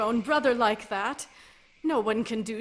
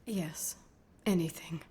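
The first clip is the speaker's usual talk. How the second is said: barely breathed, defeated; broken whisper; 7 semitones lower, 6 dB quieter than usual. Acted. Lady giving in to Kreia, broken whisper. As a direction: whisper it, beaten.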